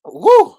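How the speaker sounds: short, loud, high "woo"; sudden whoop. Real, not acted. Host reacting between comments, sudden whoop.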